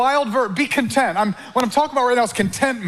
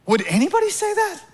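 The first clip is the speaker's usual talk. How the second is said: incredulous; 10 semitones higher than usual. Acted. Preacher mocks greedy listener.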